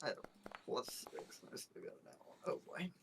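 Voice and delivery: muttering. Real, not acted. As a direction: mutter it to yourself. Muttering over a failed roll.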